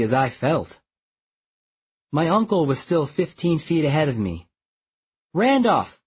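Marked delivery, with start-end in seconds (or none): 5.3-6.0 calling out. Acted. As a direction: call his name down the bridge. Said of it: "I called".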